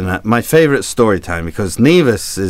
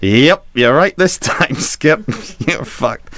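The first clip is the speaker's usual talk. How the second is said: drawn-out, higher crewman's confirmation; comic blunt sailor; crew's frank verdict; 5 semitones higher, slower than usual. Retold or imitated. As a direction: stretch the yep, then break the bad news. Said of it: "the crew rushed over and said".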